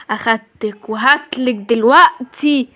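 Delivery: slow, low monster voice; monster threat. Acted. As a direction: loom and menace slowly. Giant dad threatens.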